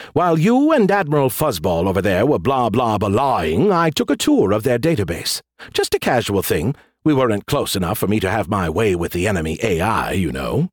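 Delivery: cocky AI with a swooping lilt; Skippy. Acted. AI bragging to the general about a hack, smug. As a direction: boast offhandedly, as if it were nothing.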